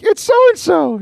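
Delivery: put-on high-pitched little kid voice; eight-year-old boy. Little brother answers over the headset, high child voice. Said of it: "Seth's eight-year-old brother".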